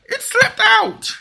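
very high, drawn-out squeaky apology; slow high whine. Pretend. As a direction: stretch it out like a whimpering dog. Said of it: "horrible, horrible man".

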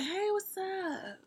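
high, slow, sugary mock greeting; fake friendly. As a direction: sing it sweetly and fake. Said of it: "I'm gonna be like".

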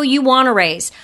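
firm statement, falling at the end; declarative. Shown as a demonstration. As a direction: state it outright with no hedge. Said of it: "the words that weaken".

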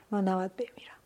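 whispered fear of dying; hushed, scared murmur; frightened whisper. Retold or imitated. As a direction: whisper it as if terrified to say it aloud. Fearing death in the storm.

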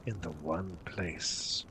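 ominous fortune teller. Acted. Seer names the Dark Lord.

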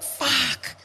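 high, soft dismayed "Fuck"; dismayed curse. Retold or imitated. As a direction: let it slip out in quiet horror. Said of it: "the old boy's like".

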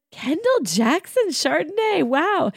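put-on high, impressed Irish accent on "Kendall Jackson Chardonnay"; imitating Irish accent. The Irish boss admiring the gifted bottle, in a mock Irish accent. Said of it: "in her Irish accent".

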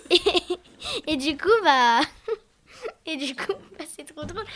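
crying with laughter, breaking up; words squeezed out through giggles; laughing hard. Real, not acted. Cracking up over funny video.

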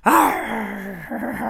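a hammed-up squawking arr; pirate parrot. Pirate day joke about her parrot, mock squawk. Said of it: "my Pirate Polly on my shoulder".

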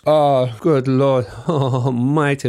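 slow, drawn-out rapture over the food; savoring delight. Real, not acted. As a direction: moan it like pure pleasure. Raving about jerk pork.

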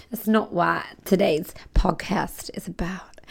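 slow whispered aside; a hushed whisper to the listener. Real, not acted. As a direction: lean in and whisper it slowly. Aside after silly bit.

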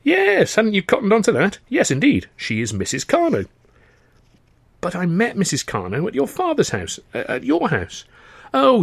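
high, breezy upper-class chatter; chatty young toff. Acted. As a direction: play a cheerful, eager young gent. Freddie voiced in dialogue.

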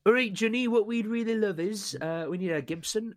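broad West Country farmer voice; West Country accent. Acted. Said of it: "in the West Country accent".

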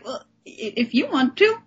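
high, timid hesitant voice; mock shy girls accepting an invitation, high and hesitant; shy bashful girl. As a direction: act coy and unsure, like a first date. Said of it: "And they're like".

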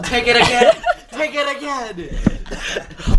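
high, loud yell through laughter; playful full-voiced shouting, laughing; mock yell. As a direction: yell it out like a demanding director. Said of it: "We, we all just yell".

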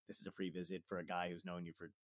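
quiet reasoning with yourself; inner thought. Acted. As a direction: say it under your breath, as if thinking. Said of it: "I'm always in my head like".

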